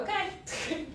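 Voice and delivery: a high okay broken by laughter; laughing okay. Retold or imitated. Egging her sister on about the date, laughing. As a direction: laugh through the word.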